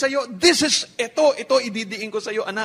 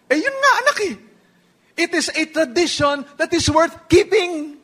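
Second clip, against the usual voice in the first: higher, lively proud papa; mock fond father; 7 semitones higher than usual. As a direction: beam as the dad proving his point. Father answers son in dialogue.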